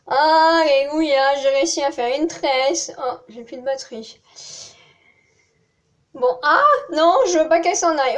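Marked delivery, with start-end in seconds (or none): 0.1-1.3 loud, slow, drawn-out cry of victory; loud, stretched Alléluia of relief; triumphant hallelujah. Real, not acted. Finally finishes the braid. 6.1-8.2 high, loud and fast; loud, quick, alarmed plea; urgent panic. Real, not acted. Braid about to come undone.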